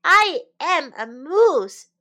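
loud, slow storybook protest; crying out. A narrator's reading. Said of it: "cried Morris".

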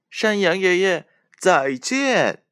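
put-on high, loud chorus of kids; children in unison. A narrator's reading. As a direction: call out goodbye together. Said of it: "他们异口同声地说".

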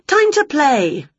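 high, drawn-out call to play; eager urging. Acted. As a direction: summon a pet to play, high and stretched. Winnie urges cat to play.

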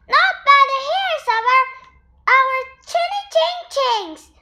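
high, fast squeaky piggy refusal; tiny pigs standing their ground; defiant little pigs. A narrator's reading. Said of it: "answered the little pigs".

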